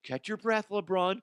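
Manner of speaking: a hint of gentle baby talk; lightly voiced ref. Ref calming LeBron, light mock baby talk. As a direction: soothe him half-seriously.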